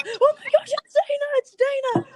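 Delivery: high, loud squealing fangirl shriek; imitating girls screaming at spotting her; starstruck fans. Girls recognizing Dana, high excited shriek. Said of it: "they look like".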